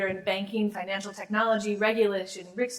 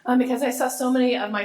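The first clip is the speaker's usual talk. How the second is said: slightly hoarse, strained voice; hoarse from a long night; losing voice; 4 semitones higher, 7 dB louder than usual. Real, not acted. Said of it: "I'm losing my voice a little bit".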